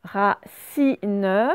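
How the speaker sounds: RACINE as RA-CI-NE, each beat apart; teacher separating the syllables of RACINE; split into syllables. Shown as a demonstration. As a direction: sound out RACINE with a voiced final E. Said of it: "Deuxième syllabe aussi".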